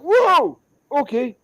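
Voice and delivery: high, loud yelp dropping in volume; startled reaction. Retold or imitated. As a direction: recoil in acted alarm. Startled reply to squatter's shout.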